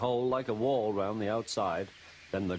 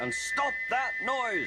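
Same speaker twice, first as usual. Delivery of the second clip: bellowing; slower than usual. A narrator's reading. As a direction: roar the order across the yard. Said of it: "he bellowed".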